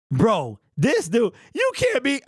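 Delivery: can't believe it, breaking up laughing; disbelieving laugh. Real, not acted. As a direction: voice jumps high into a laugh. Disbelief at a chat comment.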